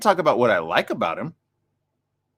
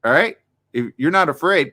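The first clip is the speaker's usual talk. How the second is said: ordinary talk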